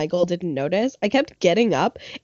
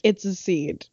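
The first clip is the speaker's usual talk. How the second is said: deadpan retort; 5 dB quieter, slower than usual. Retold or imitated. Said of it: "I'd be like".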